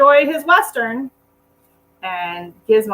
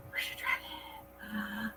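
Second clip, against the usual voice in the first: whisper; 18 dB quieter, much slower than usual. Real, not acted. Asking dog for toy.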